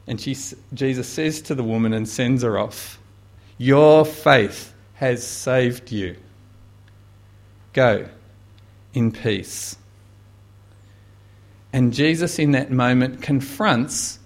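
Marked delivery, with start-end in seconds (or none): 3.6-9.8 tender blessing. Acted. Jesus sends woman away.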